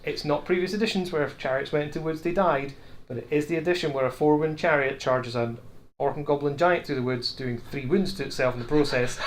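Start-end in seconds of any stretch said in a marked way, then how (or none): none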